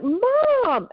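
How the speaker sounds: high-pitched call, imitating her own yell; retold yell. Daughter to mother banging on the back door, retold yell. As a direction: call out sharply to someone hard of hearing. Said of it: "I'm yelling 'cause she's".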